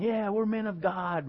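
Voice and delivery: high, slow cheer without real volume; stretched-out mock rally yell; mock crowd shout. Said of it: "fifty thousand men shouting".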